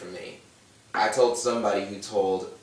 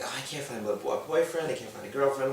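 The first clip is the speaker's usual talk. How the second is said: higher, mocking whine; mock whining; 5 semitones higher, faster than usual. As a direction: parody the complainers. Mocking people complaining about dating.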